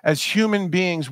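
ordinary talk, nothing marked

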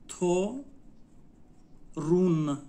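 تو, long pause, then a firm رون; slow teaching demo of تو، رون; syllable by syllable. Shown as a demonstration. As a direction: sound out تو، رون as two separate beats. Teacher breaks word into syllables.

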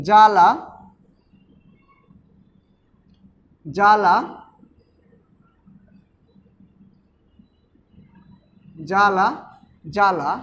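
জ্বালা said "jala", no w sound; careful, separate "ja-la" with the ব dropped; silent ব-ফলা. Shown as a demonstration. Teacher showing students the silent letter, জ্বালা as careful "jala". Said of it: "জ্বালা উচ্চারণ করি তাহলে কিন্তু".

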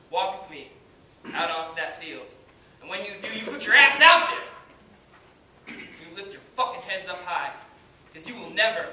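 cocky washed-up pitcher, swaggering speech; brash halftime pep speech, full of bravado; Kenny Powers. Acted. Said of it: "as Kenny Powers".

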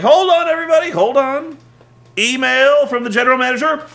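high, loud mock announcer; Cole's big announcement. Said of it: "has to make this big announcement".

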